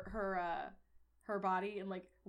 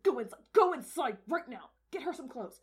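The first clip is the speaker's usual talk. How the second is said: urgent commands bursting louder at the end; frantic orders; 7 semitones higher, 5 dB louder than usual. Acted. Father sends his wife for clothes, frantic. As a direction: order them inside in a panic. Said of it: "he's, like, freaking the fuck out".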